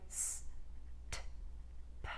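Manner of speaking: "S, T, P" as bare breath sounds; voiceless consonants. Shown as a demonstration. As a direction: make "S, T, P" with no voice. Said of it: "there's no vocalization on those sounds".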